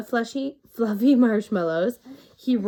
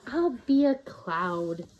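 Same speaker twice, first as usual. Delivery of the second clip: dreamy porcupine voice; slower than usual. A narrator's reading. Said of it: "he thought".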